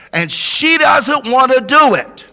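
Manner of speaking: rally the room with a rising shout; preaching at full force. Acted. Pastor urging the congregation to build bridges, full preaching cry.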